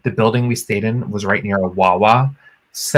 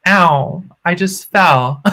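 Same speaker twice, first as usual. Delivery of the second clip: higher-pitched, badly faked yelp of pain; faked injury cry; 7 semitones higher, much slower than usual. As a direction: act out a pretend fall. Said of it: "I literally pretended to sprain my ankle".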